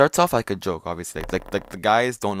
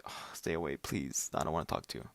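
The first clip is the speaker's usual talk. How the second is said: much softer, muttered ugh of disgust; disgusted brush-off; 5 semitones lower, 12 dB quieter than usual. Retold or imitated. Brushing off douchey school bros, softly disgusted. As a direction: push them away under your breath. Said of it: "you're just like".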